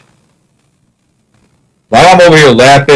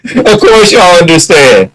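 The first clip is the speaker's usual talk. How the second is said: mock reassurance; 5 semitones higher than usual. Sarcastic after April Fools joke.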